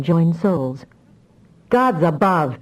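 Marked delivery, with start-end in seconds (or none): 1.7-2.6 hoarse crackle. A narrator's reading. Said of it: "a voice crackled hoarsely through the comlink".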